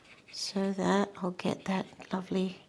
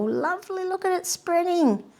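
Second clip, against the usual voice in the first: bright rising delight, higher and louder; 11 semitones higher, 5 dB louder than usual. Real, not acted. Painter thrilled as colour spreads across the wet paper, delighted.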